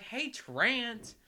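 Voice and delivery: high-pitched Southern heckle; southern accent. Retold or imitated. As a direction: holler like a Southern ball fan. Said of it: "with a southern accent".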